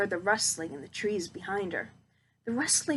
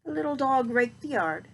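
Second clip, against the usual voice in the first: usual speaking voice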